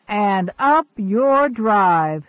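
slow, loud gloating crow. A narrator's reading. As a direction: rub it in triumphantly. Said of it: "crowed his companion".

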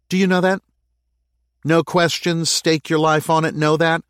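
high, loud demanding questions; challenging mayor. Acted. Lana challenges Jake.

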